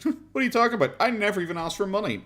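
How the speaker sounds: high-pitched feigned bafflement; mock innocent denial. Commentator mimicking a YouTuber denying his begging, mock innocent. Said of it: "he goes like".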